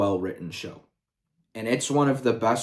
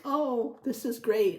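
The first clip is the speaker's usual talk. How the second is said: put-on childlike excitement; 12 semitones higher, 4 dB quieter than usual. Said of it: "and I was like".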